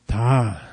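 long, low voiced 'ta'. Shown as a demonstration. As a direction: draw out 'ta' low in the throat. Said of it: "now you have three sounds already".

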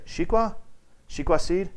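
high questioning Shikwa; rising, puzzled Shikwa? as the locals ask it; mock asking Shikwa seed with a high rise; imitating locals. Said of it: "they always say".

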